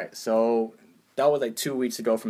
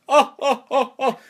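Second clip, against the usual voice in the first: very high, loud mock guffaw; hammed-up imitation of someone laughing; mocking imitated laugh; 14 semitones higher, 8 dB louder than usual. Engineer laughing at man who speaks no Spanish, mocking laugh. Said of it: "you find this humorous".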